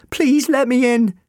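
high, slow, drawn-out plea; pleading. A narrator's reading. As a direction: beg loudly and desperately. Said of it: "Sally pleaded".

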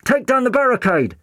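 high, loud call from inside a wall; muffled call. A narrator's reading. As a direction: shout with your head inside a wall cavity. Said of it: "the doctor's muffled voice called from inside the wall".

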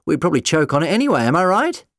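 yelling. Acted. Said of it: "the doctor yelled back".

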